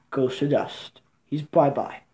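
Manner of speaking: usual speaking voice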